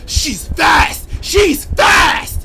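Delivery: high loud frightened shout; panicked yelling. Real, not acted. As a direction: yell while running for your life. Fleeing monster.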